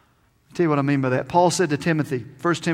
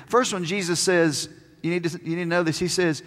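ordinary talk

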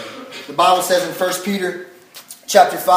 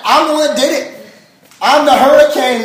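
higher, louder heartfelt confession; emphatic preaching; 6 semitones higher, 7 dB louder than usual. Real, not acted. Pastor confessing to the crowd he hurt his son, emphatic. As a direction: press the point with rising passion. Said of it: "passionate confession".

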